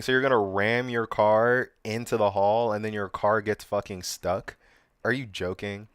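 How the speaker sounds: ordinary delivery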